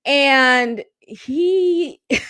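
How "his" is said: ordinary talk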